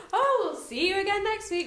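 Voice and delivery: high, loud, grinning sign-off; mock newsreader. Mocking grinning news anchors, high bright sign-off. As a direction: beam it out like a cheery anchor. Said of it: "they're kinda like".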